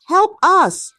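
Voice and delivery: high, loud pleading cry; desperate cry for help. A narrator's reading. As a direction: beg the village for help. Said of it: "Chiamaka cried, her voice cracking".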